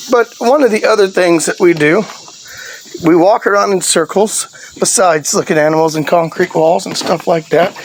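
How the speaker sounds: ordinary talk, nothing marked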